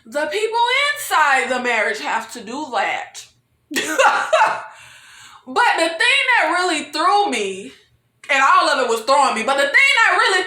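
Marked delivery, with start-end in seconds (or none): 3.7-4.7 high burst of laughter. Real, not acted. Commentator cracking up at the show, high laughing. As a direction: break out in a real laugh.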